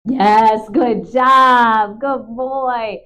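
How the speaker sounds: big, loud praise for a good dog. Real, not acted. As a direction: reward him with a loud, happy cheer.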